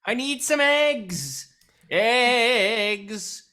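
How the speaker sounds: calling out loud down the street; shouting. Acted. Player character shouting down the street for eggs. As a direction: holler for eggs to anyone listening.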